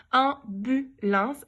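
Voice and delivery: stretched slowly syllable by syllable; slow syllable-timed. Shown as a demonstration. Teacher modelling slow sounding out for pupils. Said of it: "j'entends le bu en deuxième".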